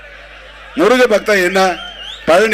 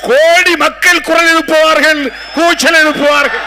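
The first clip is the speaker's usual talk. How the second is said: slow, higher rally cry; battle yell; 8 semitones higher, 3 dB louder than usual. Acted. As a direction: rouse the crowd like a war cry. Rally climax, crowd-rousing cry.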